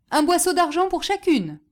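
high, loud announcement; calling out a price. A narrator's reading. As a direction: proclaim the price to the crowd. Hawker calls out his price.

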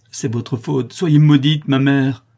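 loud, high anguished curse; heart-rending outburst. A narrator's reading. Condemned daughter cursing her mother, anguished outburst. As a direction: build to a cry of blame. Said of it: "s'écria Calebasse d'une voix déchirante".

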